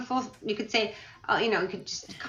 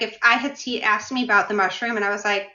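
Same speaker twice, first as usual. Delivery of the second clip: ordinary delivery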